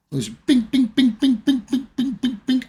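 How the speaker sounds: high, loud beeping bings; imitated button beeps. Patient gleefully pressing morphine button, high bings. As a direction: sing out the morphine pump beeps.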